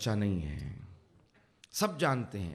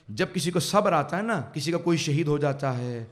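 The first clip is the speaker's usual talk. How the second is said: normal talking voice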